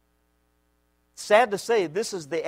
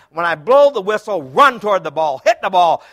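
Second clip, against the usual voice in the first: rallying shout; 3 semitones higher, 8 dB louder than usual. Retold or imitated.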